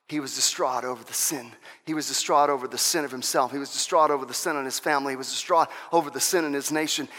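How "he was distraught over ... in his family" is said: usual speaking voice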